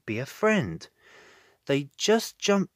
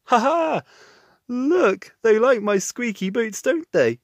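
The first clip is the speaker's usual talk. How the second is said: amused laugh; 8 semitones higher, 7 dB louder than usual. A narrator's reading. Bob amused.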